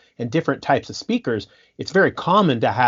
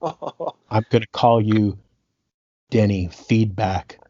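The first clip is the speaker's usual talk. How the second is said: slow, lower, deadpan menace; mock threat; 3 semitones lower, much slower than usual. Boss threatening his sound engineer, slow low mock menace. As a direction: drag the nickname out as a threat. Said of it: "Prince just stops and he goes".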